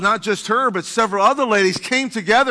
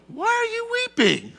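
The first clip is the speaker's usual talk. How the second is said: high gentle angel question; 7 semitones higher, 7 dB quieter, slower than usual. Retold or imitated. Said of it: "for them, it would be natural".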